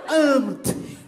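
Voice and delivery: "Amo-te" burst out high and loud as "amt"; swallowed vowels. Shown as a demonstration. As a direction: exaggerate the eaten vowels in "Amo-te". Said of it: "Eles comem as vogais".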